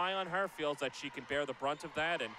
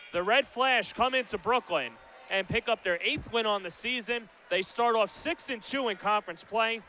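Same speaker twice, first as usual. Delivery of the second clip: higher, louder closing call of the game; final-horn wrap-up; 4 semitones higher, 4 dB louder than usual. Real, not acted. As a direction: announce the result with energy.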